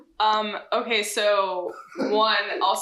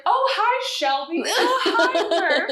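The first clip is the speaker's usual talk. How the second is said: high, sing-song welcome; cheery greeting; 9 semitones higher than usual. Retold or imitated. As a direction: greet old friends brightly, up high. Bank staff now greeting warmly.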